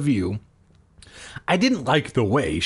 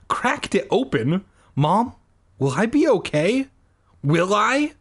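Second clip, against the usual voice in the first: higher, panicked questions; frightened demand; 7 semitones higher than usual. Acted. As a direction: demand it in fear. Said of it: "I demanded".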